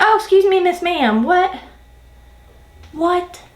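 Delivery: retold indignant comeback with questioning whats; indignant disbelief. Job seeker retelling her reply to bad news, indignant disbelief.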